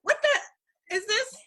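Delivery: high, slow, bewildered outburst; like nothing makes sense; baffled exclamation. Retold or imitated. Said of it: "We're like".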